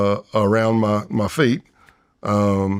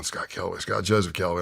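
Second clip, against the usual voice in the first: muttering to self; 3 semitones lower, 8 dB quieter than usual. Retold or imitated. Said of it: "I'm going".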